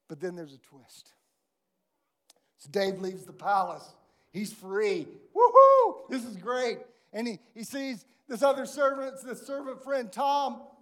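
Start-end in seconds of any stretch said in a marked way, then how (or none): 5.3-6.8 very high, loud whoop of glee; joyful whoop. Acted. Dave leaves the palace free, whooping for joy. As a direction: cheer like a man just set free.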